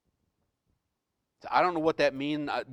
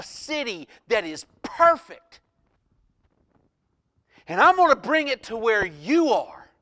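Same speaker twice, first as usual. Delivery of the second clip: raised, impassioned voice of God; God speaking; 6 semitones higher, 7 dB louder than usual. Acted. God promising his child a city, loud and fervent. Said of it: "and he's saying".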